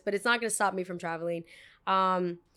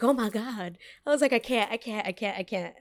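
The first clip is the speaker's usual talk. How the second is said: retold panic; 2 semitones higher than usual. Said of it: "full panic attack".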